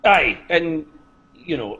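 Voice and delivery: ordinary delivery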